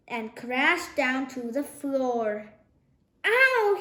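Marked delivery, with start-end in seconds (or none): 3.2-3.8 high, loud yelp of pain; cry of pain. A narrator's reading. Dad crying out after crashing down. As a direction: yelp as you hit the floor. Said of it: "he cried".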